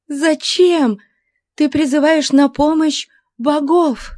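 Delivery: high impassioned woman's voice; exclaiming. A narrator's reading. Captive challenges her captor's prayer, high exclamation. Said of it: "воскликнула она".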